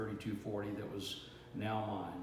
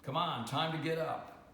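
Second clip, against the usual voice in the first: higher, slow call to get up; rousing wake-up call; 6 semitones higher, 3 dB louder than usual. Acted. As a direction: rouse a sleeper with a drawn-out, raised voice. Grandfather wakes the boy for chores.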